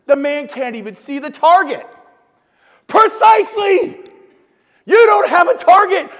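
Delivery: nail the punchline with a big shout; shouting the story's punchline at the room; triumphant shout. Real, not acted.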